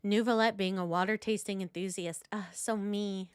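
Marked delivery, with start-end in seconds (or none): none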